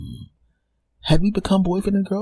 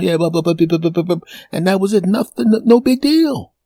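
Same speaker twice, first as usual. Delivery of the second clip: normal talking voice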